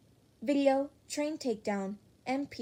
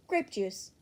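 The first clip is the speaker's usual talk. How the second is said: usual speaking voice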